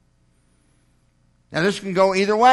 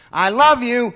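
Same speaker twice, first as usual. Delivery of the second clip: higher, louder, earnest declaration; spouse saying I love you; 6 semitones higher, 6 dB louder than usual. Acted. Spouse speaking to their mate, higher earnest declaration. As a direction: say it like you mean it to your mate.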